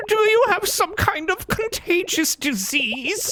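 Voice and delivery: very high, squeaky cartoon voice; squeaky character voice. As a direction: squeak like a panicky cartoon critter.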